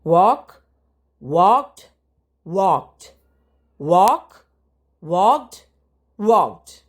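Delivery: punchy classroom drilling of verb forms; loud drill repetition. Shown as a demonstration. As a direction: call out each form for the class to repeat. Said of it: "teacher drills walk and walked".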